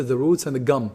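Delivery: ordinary talk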